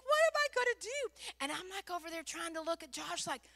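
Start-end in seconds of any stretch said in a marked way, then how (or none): 0.0-1.0 high, loud mock wail; hammed-up desperate plea; acted panic. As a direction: cry out helplessly, pitched way up. Retells panicked thought.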